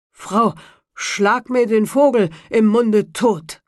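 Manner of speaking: slow, loud order to his wife; commanding carter. A narrator's reading. Carter ordering his wife to kill the bird, slow and loud. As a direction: demand it with a full mouth.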